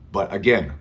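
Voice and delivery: usual speaking voice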